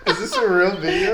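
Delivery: high, loud, laughing through the words; laughing question. Real, not acted. Listener asks if the video is real while laughing. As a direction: ask while still cracking up.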